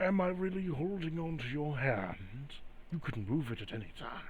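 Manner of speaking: soft, teasing spirit voice; hushed, needling, as if feigning innocence; taunting entity. Acted. Entity taunts Graves about holding his hand, hushed teasing.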